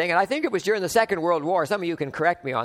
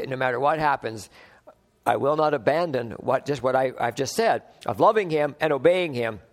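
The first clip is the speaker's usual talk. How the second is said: ordinary delivery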